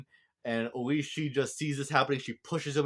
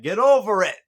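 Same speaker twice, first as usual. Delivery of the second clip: high, loud impatient yell; exasperated shout; 9 semitones higher, 14 dB louder than usual. Retold or imitated. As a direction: snap it at them like they're being ridiculous. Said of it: "would be like".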